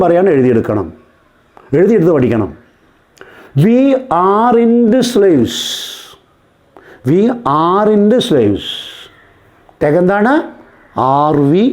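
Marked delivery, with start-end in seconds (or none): 3.7-8.7 drawn-out, very slow teacher's dictation. Shown as a demonstration. Teacher repeats the sentence for students writing it down, slow dictation. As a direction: spell out each word slowly for note-takers. Repeats slowly for note-taking.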